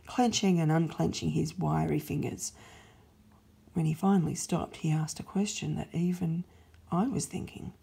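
usual speaking voice

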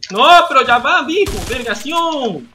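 loud, then slowed, drawn-out exclamation; excited exclamation. Real, not acted. Streamer exclaims over a trap that worked. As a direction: burst out, then stretch it.

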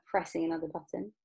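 usual speaking voice

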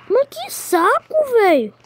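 high, slow fed-up groan; exasperated. Real, not acted. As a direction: whine it in exasperation. Annoyed at teammate.

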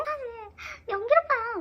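high, slow giggling reveal; shy laughing confession. Retold or imitated. (수줍게 웃으며 고백)

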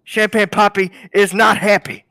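loud, high furious outburst; intense. Acted. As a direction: blow up at him in anger. Boss furious about leaked [name] vocals.

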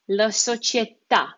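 società with the last à stressed; final stress on società. Shown as a demonstration. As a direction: hit the final syllable of società. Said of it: "this is where the emphasis goes".

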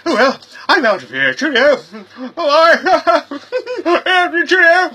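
high-pitched giggling cheerios; high squeaky visitor leaving. Acted. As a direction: squeal goodbye as you dash off.

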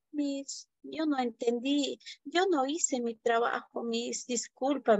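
put-on boy confessing to the teacher he did no homework; timid apology. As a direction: apologise to the teacher, barely audible. Shy boy admits no homework.

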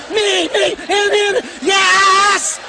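high, hammed-up eager squeals; eager clamouring. Drivers grabbing the chance to enter, mock eager clamour. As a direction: squeal it, bursting out on the last word. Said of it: "'cause they're saying".

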